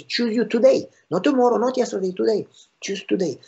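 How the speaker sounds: today pushed high and fast, then settling; insistent preacher's call; urgent emphasis on today. Acted. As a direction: press the word today hard. Preacher urging choice of today.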